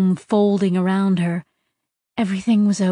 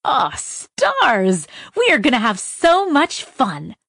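excited; 4 semitones higher, slower than usual. Acted. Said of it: "She shut her eyes and laughed".

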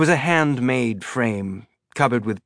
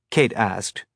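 usual speaking voice

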